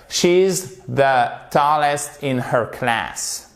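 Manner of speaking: She's the tallest said slowly for learners; slow, clear teacher reading; slow model reading. Shown as a demonstration. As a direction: read it out slowly, word by word. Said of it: "model sentence read slowly".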